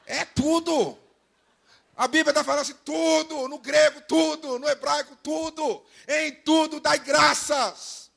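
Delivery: high, emphatic proclamation, stretched key words; full-force preaching. Real, not acted. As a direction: drive the point home to the congregation. Preacher drives the point home.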